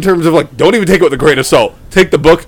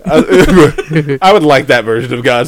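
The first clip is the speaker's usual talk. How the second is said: ordinary delivery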